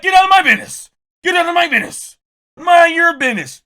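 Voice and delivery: loud, high mocking yell; mock shout. Host imitates Akadidi yelling at critics. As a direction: shout it like a catchphrase. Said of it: "You be like".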